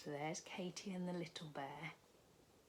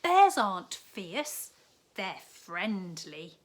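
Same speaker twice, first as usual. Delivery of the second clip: put-on loud, slow reassuring girl; slow it down to convince; girl telling Tom not to worry; Katy's voice; 2 semitones higher, 13 dB louder, slower than usual. A narrator's reading. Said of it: "called Katy".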